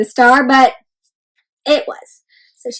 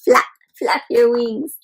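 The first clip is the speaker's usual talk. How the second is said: ordinary delivery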